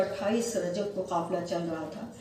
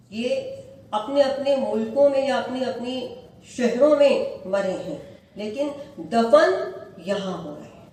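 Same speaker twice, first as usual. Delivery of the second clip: louder, rising majlis lament; full-force preaching; 5 dB louder than usual. Acted. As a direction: preach it to the mourners with swelling grief. (پرجوش مجلس کا خطاب)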